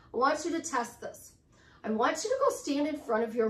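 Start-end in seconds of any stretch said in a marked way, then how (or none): none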